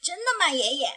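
high, eager cub voice asking grandpa; wide-eyed little cub. A narrator's reading. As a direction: sound like an amazed little bear. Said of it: "小熊睁大眼睛问道". (小熊睁大眼睛问爷爷，高而惊奇)

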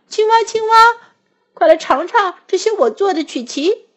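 very high, eager call. A narrator's reading. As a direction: shout the friend's name happily. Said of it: "癞蛤蟆叫道".

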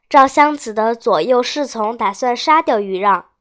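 ordinary delivery, nothing marked

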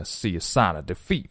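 "sign" opened out to "sahn", low in pitch; exaggerated vowel for I. Shown as a demonstration. Teacher shows the feel of it, low "sahn" for "sign". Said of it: "这种感觉".

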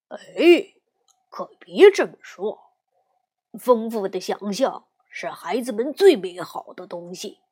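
lightly voiced patient bear dad; papa bear voice. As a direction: explain like a kind father. Papa encourages daughter.

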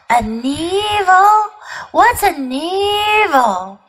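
high, drawn-out puzzlement; puzzled questioning. A narrator's reading. Creatures asking each other about a new word, puzzled. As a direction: puzzle slowly over the strange word.

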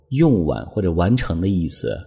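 normal talking voice

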